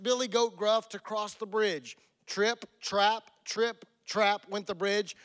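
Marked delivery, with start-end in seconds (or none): none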